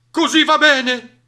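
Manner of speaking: high, loud, drawn-out cheer of agreement; chorus of animals agreeing. A narrator's reading. All the animals agree to the plan, high excited voice. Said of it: "dissero tutti d'accordo".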